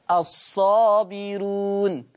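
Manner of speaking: الصابرون recited very slowly, the ر light after the kasra; slow recitation. Shown as a demonstration. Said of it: "هذه الراء مضمومة".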